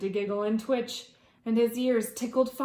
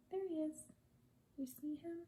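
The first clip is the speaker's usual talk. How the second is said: soft, higher pointing out the picture; 7 semitones higher, 14 dB quieter, much faster than usual. Real, not acted. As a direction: point at the page and whisper excitedly. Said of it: "reader points out picture softly".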